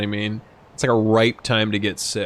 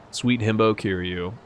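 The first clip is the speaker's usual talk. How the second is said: usual speaking voice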